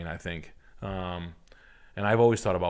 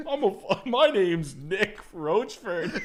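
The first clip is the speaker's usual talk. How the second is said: high, slow, giggly cartoon dog; hammed-up Goofy; laughing Goofy voice; 10 semitones higher, much slower than usual. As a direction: introduce himself in the mascot voice, cracking up.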